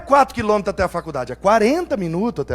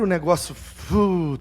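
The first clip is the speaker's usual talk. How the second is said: ordinary talk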